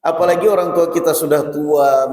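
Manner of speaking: normal talking voice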